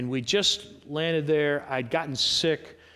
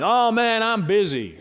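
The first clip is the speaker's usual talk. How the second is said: louder, higher mock-frazzled complaint; harried busy person; 6 semitones higher, 6 dB louder than usual. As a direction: play someone overwhelmed by work. Acting a harried busy worker.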